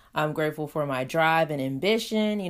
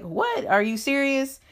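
mock disbelief; 7 semitones higher than usual. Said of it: "she was pretty shocked about it".

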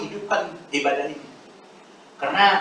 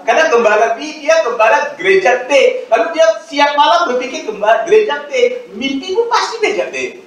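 mocking tone; 9 semitones higher, 7 dB louder than usual. Mocking a rival pastor's dreams.

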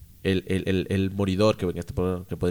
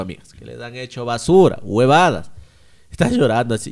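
ordinary talk

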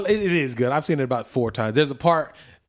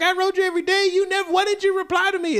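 put-on high-pitched girlfriend voice. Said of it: "She's like".

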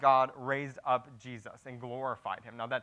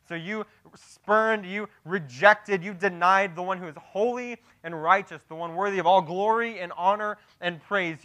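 forceful, measured pulpit indictment; impassioned preaching; 6 semitones higher, 9 dB louder than usual. Acted. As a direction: lay out each charge with weighty emphasis. Preacher presses the accusation.

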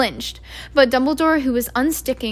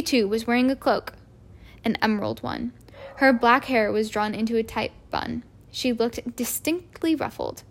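ordinary delivery

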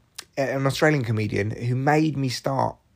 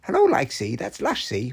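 put-on Welsh accent. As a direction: imitate a Welsh woman's chat. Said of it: "That's my Welsh accent".

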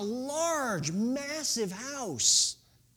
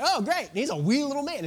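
sarcastic; 6 semitones higher, 7 dB louder than usual. Retold or imitated. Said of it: "I was like".

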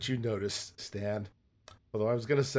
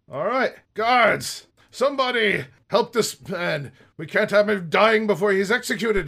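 commanding shout; 7 semitones higher, 7 dB louder than usual. Acted. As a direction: bark orders at the guards. Guard captain calls for help.

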